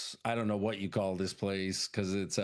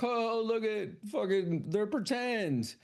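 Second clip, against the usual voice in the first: put-on higher, slow amused girl voice; mock girl voice; 10 semitones higher, slower than usual. Woman finding fake cows, lightly higher girl voice. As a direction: lightly voice a curious young woman. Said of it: "she's like".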